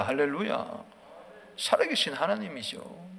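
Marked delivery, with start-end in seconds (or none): none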